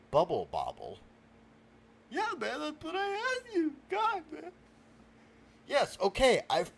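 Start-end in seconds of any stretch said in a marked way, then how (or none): 2.1-4.5 very high whining protest; tearful frustration. Acted. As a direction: wail it softly, close to tears. Near tears at being misunderstood.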